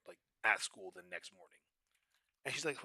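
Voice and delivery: ordinary talk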